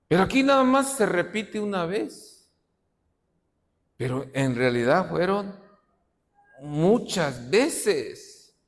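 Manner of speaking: ordinary talk, nothing marked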